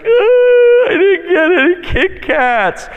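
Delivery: hammed-up sobbing child; whiny crying kid. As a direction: wail like a kid missing his candy. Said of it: "they're crying".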